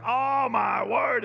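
slow, high, loud exasperated shout; exasperated cry. Retold or imitated. Pastor crying out, fed up with Facebook, shouting slowly. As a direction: cry out at the end of your patience. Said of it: "it's just like".

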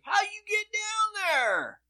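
very high, slow called-out question; mock distant shout; shouting from above. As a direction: yell down from the balcony. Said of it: "you hear 'em shouting from up top".

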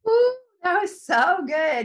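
high, loud, drawn-out delight. Real, not acted. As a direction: react thrilled to what you just heard. Host reacts to the blessing.

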